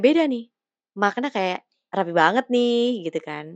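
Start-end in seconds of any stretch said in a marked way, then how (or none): 1.9-3.1 higher, drawn-out playful comment; casual teasing. Shown as a demonstration. Someone remarking on a friend's neat outfit, playfully. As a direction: tease lightly, just for fun. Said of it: "itu kayak iseng".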